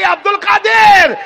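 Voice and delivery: high, loud, slow divine call; an unseen voice calling out to Abdul Qadir. Retold or imitated. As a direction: proclaim it like a voice from above. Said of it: "غیب سے آواز آئی".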